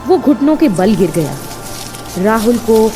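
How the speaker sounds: usual speaking voice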